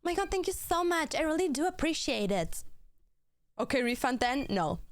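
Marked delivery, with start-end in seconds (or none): 0.0-2.6 mock gushing thanks.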